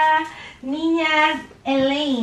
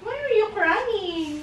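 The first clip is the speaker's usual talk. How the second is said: soothing; 6 semitones higher, 5 dB quieter, slower than usual. Real, not acted. Soothing the upset child.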